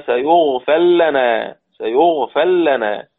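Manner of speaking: سيغفر لنا slowed, the R dropped into a doubled L, "yaghfil-lana"; teacher demonstrating the R merged into the L; merging r into l. Shown as a demonstration. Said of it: "والراء مضمومة تضغم".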